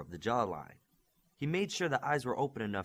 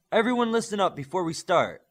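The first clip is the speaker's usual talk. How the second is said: loud, higher call to the whole group; said loudly; 8 semitones higher, 8 dB louder than usual. A narrator's reading. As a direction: call everyone to attention, loud and raised. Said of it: "Olympian said loudly".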